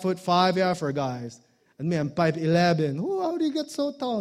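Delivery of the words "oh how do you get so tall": high quick amazed aunty. Retold or imitated. People asking his height.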